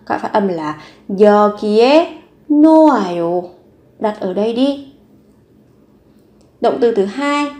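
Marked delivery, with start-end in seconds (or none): 1.2-3.4 "놓아요" linked as no-a-yo, h silent. Shown as a demonstration. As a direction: drop the ㅎ in "놓아요" and glide into the vowel. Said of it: "Âm hờ này được coi là âm câm". ("놓아요" đọc là nô-a-yô, âm h câm)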